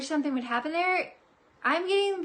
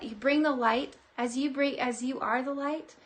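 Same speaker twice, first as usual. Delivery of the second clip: normal talking voice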